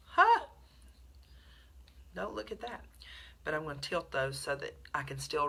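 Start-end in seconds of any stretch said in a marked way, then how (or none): 0.1-0.5 sharp laugh. Real, not acted. Laughs at something off camera.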